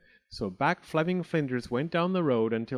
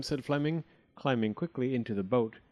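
usual speaking voice

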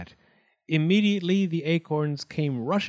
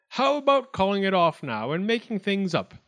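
raised pitch, coaxing appeal; lightly voiced Wildcat judge; 6 semitones higher than usual. A narrator's reading. Wildcat urges acorns to make up, raised official voice. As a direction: appeal to the whole court.

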